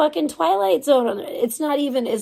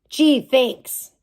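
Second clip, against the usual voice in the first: sarcastic thanks; 5 dB louder than usual. Real, not acted. Sarcastic thanks for partial payment.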